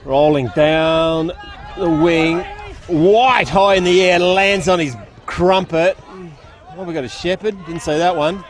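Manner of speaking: brisk live football call from the box; play-by-play commentary. Acted. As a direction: call the game as it unfolds, rising with the play.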